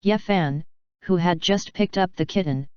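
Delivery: usual speaking voice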